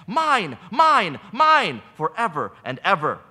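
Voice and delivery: a mother shouting down anyone who keeps her son from her; furious grieving mother. Acted.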